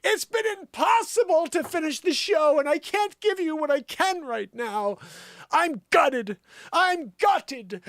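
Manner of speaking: high, distraught mock-diva delivery. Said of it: "She did it like this".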